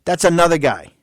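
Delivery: ordinary delivery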